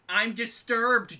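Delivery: loud mock dismay; loud slow exclaim. Real, not acted. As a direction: declare it loudly and slowly. Reacting to camera.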